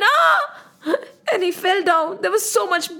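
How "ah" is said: loud, high "Ah!"; acted yell, pitched way up; shouted cry of pain. A narrator's reading. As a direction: cry out as if struck by an arrow. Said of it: "He shouted".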